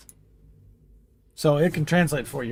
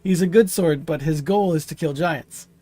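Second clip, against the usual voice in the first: normal talking voice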